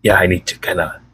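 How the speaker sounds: low, worn-out voice running dry; voice almost gone. Real, not acted. Speaker apologizing to viewers, voice nearly gone and low. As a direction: talk on gently with what little voice is left. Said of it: "before I have no voice left".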